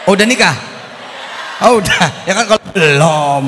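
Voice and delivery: loud, surprised "oh"; real surprise at the answer. Real, not acted. Surprised audience member is married.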